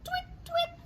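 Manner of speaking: very high, soft bird tweeting; starling tweets. Acted. Reading starlings' song to children, high tweeting. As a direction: chirp it like a tiny bird. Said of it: "The starlings chittered".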